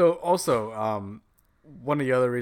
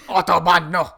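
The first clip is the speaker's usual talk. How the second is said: loud, raised mock-German echo; aggressive German accent; 6 semitones higher, 12 dB louder than usual. Friend echoing German words, shouted German accent. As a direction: shout it back in caricature German. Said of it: "Let's just name all the German words".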